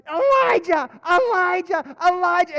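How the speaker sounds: high, loud screaming fit; panicked screaming. Retold or imitated. Servant waking his master at the army outside, screaming in panic. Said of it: "He starts freaking out".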